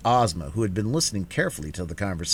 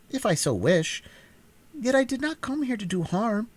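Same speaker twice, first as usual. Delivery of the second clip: higher, stately queenly voice; regal young ruler; 10 semitones higher than usual. A narrator's reading. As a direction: declare her power without threat. Ozma voiced in story.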